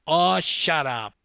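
loud, drawn-out, exasperated; snapping back. Acted. Swimmer replying to a teammate's retort, exasperated. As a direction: cut them off loudly, fed up.

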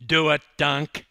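loud urging yell. Acted. Teammate egging the swimmer on, yelling. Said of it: "yelled Jody".

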